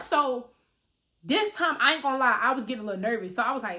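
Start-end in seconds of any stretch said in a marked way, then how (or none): none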